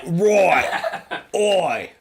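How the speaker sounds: put-on Cockney accent. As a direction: laugh through a Cockney "Oi". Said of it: "I turn into Cockney".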